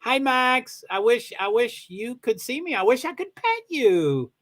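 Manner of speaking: high, affectionate cooing to a dog; sweet-talking a pup; pet-talk voice. Real, not acted.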